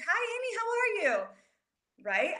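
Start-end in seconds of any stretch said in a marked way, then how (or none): 0.1-1.2 bright, much higher greeting voice; mock upbeat hello; friendly greeting. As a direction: chirp it up high like a cheerful friend. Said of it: "she says".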